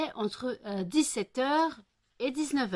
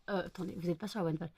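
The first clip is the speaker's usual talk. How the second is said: quick quiet aside; 4 semitones lower, 10 dB quieter, much faster than usual. Real, not acted. Aside correcting the camera.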